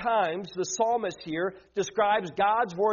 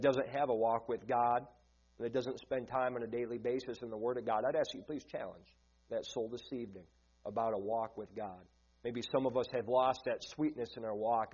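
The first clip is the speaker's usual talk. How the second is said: soft, earnest prayer voice dropping quieter; 7 semitones lower, 6 dB quieter than usual. Acted. Pastor praying before the sermon, hushed and earnest. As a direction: pray humbly to the Lord, low and hushed.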